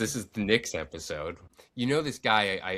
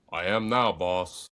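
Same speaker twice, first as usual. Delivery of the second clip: giant voice; slower than usual. Retold or imitated. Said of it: "And Andre the Giant said".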